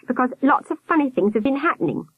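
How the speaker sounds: put-on high-pitched boy's voice; explaining boy. A narrator's reading. Albert carrying on explaining, high boy voice. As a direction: pitch it up like a child informant.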